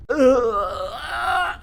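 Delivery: long, high drawn-out groan; drawn-out wailing "uh"; exaggerated groan. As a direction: groan in mock despair. Groan after forgetting his German.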